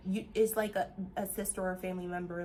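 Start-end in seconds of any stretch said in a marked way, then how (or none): none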